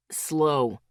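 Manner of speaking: clipped, firm single-word order; a sharp, commanding Slow. A narrator's reading. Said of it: "Asher's voice was sharp now".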